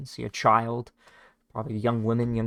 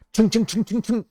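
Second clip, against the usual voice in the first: crossbow sound effect; 11 semitones higher, 4 dB louder than usual. Acted. Crossbow bolts firing.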